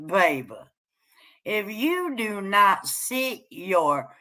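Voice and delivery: ordinary talk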